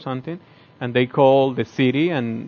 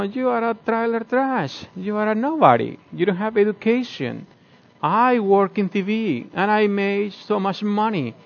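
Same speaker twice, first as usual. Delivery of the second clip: belittling insults; 7 semitones higher, 4 dB quieter than usual. Retold or imitated. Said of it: "insulting her".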